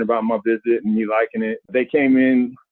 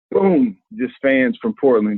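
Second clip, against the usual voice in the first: ordinary talk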